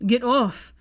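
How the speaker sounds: loud desperate shout; desperate bellow. A narrator's reading. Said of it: "he bellowed desperately".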